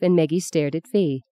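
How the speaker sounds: usual speaking voice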